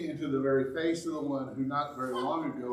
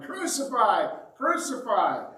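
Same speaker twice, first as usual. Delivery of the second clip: mock crowd yell, higher; 7 semitones higher, 4 dB louder than usual. Preacher voicing the chief priests yelling, mock crowd. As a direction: chant it up high like an angry mob. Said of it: "they just started yelling".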